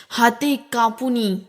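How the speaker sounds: ordinary delivery, nothing marked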